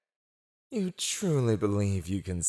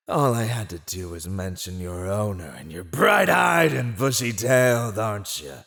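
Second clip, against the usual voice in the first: ordinary delivery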